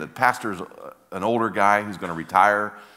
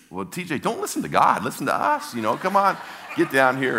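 higher, mock urging; coaxing plea; 6 semitones higher than usual. Church folk coaxing TJ to ignore God, playful plea. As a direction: coax him, pitching it up. Group coaxing him to come.

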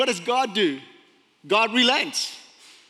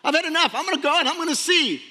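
higher-pitched mock indignation; ticked off; 6 semitones higher, faster than usual. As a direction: act fed up and defiant, pitched up. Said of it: "He's ticked off right now".

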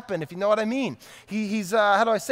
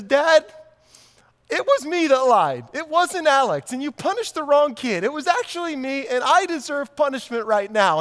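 tearful confession; 4 semitones higher, 4 dB louder than usual. Retold or imitated. Older son confessing after weeping.

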